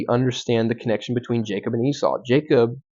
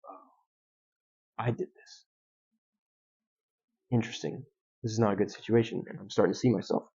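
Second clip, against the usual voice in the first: much softer, musing to himself; quiet inner realization; 10 dB quieter than usual. Retold or imitated. Jacob realizing his own deceit, soft and musing. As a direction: let it sink in under your breath.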